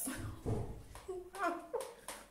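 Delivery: breathy, voiceless laughing; rueful laugh. Real, not acted. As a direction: laugh helplessly at your own foolishness. Laughs at having been conned.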